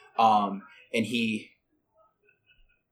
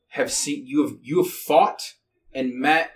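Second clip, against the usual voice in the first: ordinary talk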